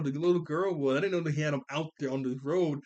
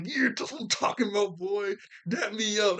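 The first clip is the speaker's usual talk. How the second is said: hyped-up approval; 6 semitones higher than usual. Retold or imitated. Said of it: "He was like".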